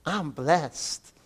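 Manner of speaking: soft Texas accent, imitating a friend; a friend's soft Texas accent. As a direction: say it softly like Sam from Texas. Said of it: "In her Texas accent".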